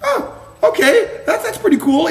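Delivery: playfully high and pleased; mock impressed. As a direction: squeak it out like you're flattered. Retold reaction to compliment.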